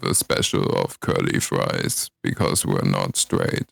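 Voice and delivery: low, slow put-on deep voice. Deep joke voice, timbre shift.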